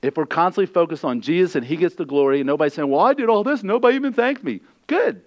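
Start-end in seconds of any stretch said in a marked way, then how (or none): 2.9-4.6 much higher, self-pitying whine; mock the complainer's whine; whiny complaint. Complaining churchgoer voiced. 4.9-5.2 much higher, punchy; emphatic high retort. Real, not acted. Preacher's retort.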